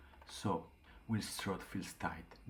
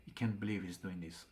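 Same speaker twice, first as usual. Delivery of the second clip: ordinary delivery, nothing marked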